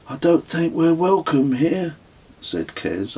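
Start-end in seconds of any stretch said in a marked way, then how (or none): 0.1-1.9 dry ironic aside in a higher put-on voice. A narrator's reading. Kez remarking on the unwelcoming squat, ironic and raised. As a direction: say it with a wry, knowing tilt.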